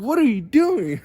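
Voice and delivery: loud, high-pitched incredulous question; acted bewilderment. Gym-goer to the yelling stranger, acted bewildered shout. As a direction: demand it in mock alarm. Acts startled reply to stranger.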